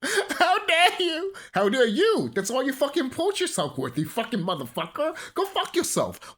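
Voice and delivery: high, hammed-up indignation; mock outrage. Vlogger answering people calling him angry, mock outrage. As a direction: play offended, pitch rising. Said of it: "I'm like".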